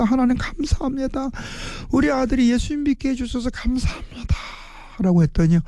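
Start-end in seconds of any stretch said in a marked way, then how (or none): 0.0-1.3 높고 여리게 북받친 "하나님". Retold or imitated. 감격한 엄마의 감사 기도. 1.9-4.3 높고 여리게 북받친 목소리; 목이 메도록 벅차게 감사하는 엄마. Retold or imitated. 감격한 엄마의 감사 기도.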